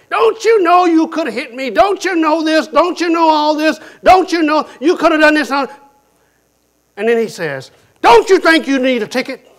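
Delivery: loud, high angry scolding; yelling cop. Retold or imitated. Police officer yelling at the driver he pulled over. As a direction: berate the driver who cut him off. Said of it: "He proceeded to yell at me".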